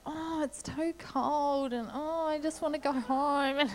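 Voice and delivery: high, slow, drawn-out whine; kids whinging. Retold or imitated. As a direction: whine like a cold, tired child. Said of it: "they just start whinging".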